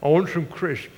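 imitating a little girl with her mouth stuffed; mock mouth-full child's garble; mouth full of cake. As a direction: mush the words through a pretend mouthful. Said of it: "She can barely speak".

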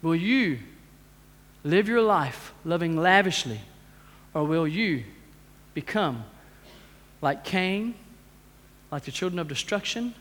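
low, soft, spaced-out challenge; hushed preaching. Real, not acted. Preacher closing with a question to congregation, hushed solemn appeal. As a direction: ask it quietly, with weighty pauses.

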